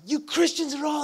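high shouted imitation of a hostile stranger; mock angry rant. Man in a Speedo yelling at John over Christianity, high mock shout. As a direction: mimic him yelling in John's face. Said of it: "spitting on him".

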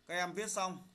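usual speaking voice

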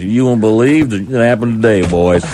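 slow, stretched Texas news anchor; Texas drawl. Pretend. Radio host imagining a Dallas newsman, in a slow mock Texas drawl. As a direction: lay on a lazy Texas drawl, like a hammed-up local newsman. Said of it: "You should have a Texas drawl".

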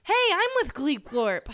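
ordinary talk, nothing marked

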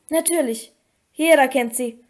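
plain reading voice

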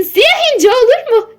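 high, loud questioning character voice; wife's eager suggestion. A narrator's reading. Mrs. Gordon proposes a name for the horse, high and bright-eyed. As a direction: pitch it well up, like an excited proposal.

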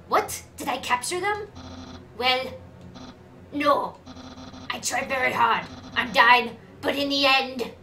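loud character reporting failure, halting; game character voice. Acted. Character admitting failure to Undyne, loud role voice. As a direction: declare it loudly, with pauses.

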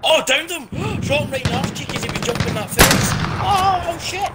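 high, fast gamer excitement; excited shouting. Real, not acted. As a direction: rattle it off thrilled, voice up high. Excited kill in shooter game.

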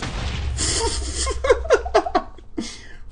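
helpless high-pitched laughter; high squealing laugh. Real, not acted. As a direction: burst into a squeaky giggle. Startled laugh during fight.

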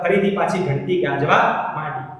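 ordinary talk, nothing marked

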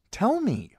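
high, loud insistent command; demanding shout. Acted. Arya pressing Ned to tell her, loud demand. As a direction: demand it now.

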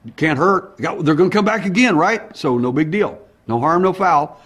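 loud and eager; talking himself into it out loud. Retold or imitated.

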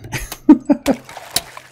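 high breathy giggle; giddy breathy giggle. Real, not acted. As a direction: giggle at your own silliness. Giddy laugh after cheering.

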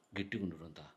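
very low, barely audible aside; hushed urging. Acted. Husband secretly urges wife to stay firm, very low and hushed. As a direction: urge it secretly under your breath.

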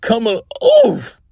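high start broken by a grunt of impact; startled "oof" as something thumps you; cut-off oof. Acted. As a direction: get hit mid-word. Engine struck mid-word by branch.

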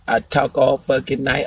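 ordinary talk

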